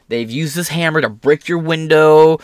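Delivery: ordinary delivery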